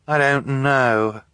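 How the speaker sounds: slow and downcast, high-pitched; miserable. Acted. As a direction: say it miserably, near defeat. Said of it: "Violet said miserably".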